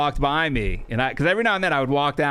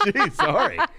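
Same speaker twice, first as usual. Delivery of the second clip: high, laughing through a mock sorry; laughing apology; 7 semitones higher than usual. Real, not acted. Retelling snapping back at an angry boss, laughing high. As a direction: giggle out the apology.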